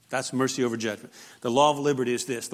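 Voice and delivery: usual speaking voice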